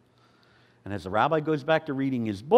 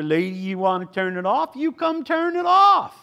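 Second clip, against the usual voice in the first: tough guy taunt; 10 semitones higher, 6 dB louder than usual. Retold or imitated. Gang member challenges the old lady.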